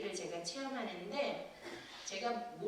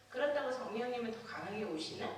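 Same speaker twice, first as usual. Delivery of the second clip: ordinary delivery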